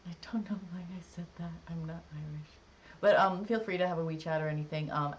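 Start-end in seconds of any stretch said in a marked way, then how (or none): 0.1-2.5 whispered under her breath to herself; embarrassed whisper. Real, not acted. Self-conscious aside.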